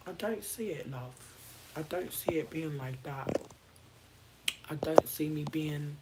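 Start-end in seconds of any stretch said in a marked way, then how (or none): 1.8-6.0 dropping to a whisper; sudden whisper, remembering it's midnight. Real, not acted. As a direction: hush it, it's late. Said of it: "I'm gonna stop screaming".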